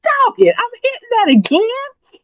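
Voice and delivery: a self-scolding inner voice, high and exasperated; mock frustrated outburst. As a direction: play your inner voice catching itself again.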